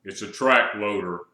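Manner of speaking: slow, low husky voice; deep put-on husky growl. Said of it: "he said with a husky voice".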